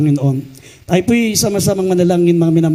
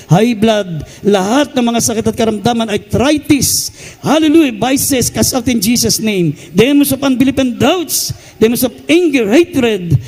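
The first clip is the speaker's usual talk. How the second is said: preaching at full force; 5 semitones higher than usual. Acted. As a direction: cast them out with full force. Rebuking demons at full force.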